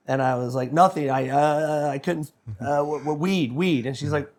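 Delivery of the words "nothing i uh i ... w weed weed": acted nervous stammering, slightly raised; retold fumbling for words; flustered stammer. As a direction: stumble through a mock confession. Acting his stammered confession to wife.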